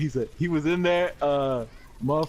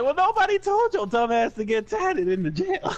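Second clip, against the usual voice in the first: laughing mockery; 9 semitones higher than usual. Inmates mocking, laughing.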